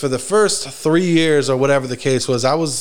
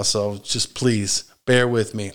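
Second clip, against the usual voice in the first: ordinary talk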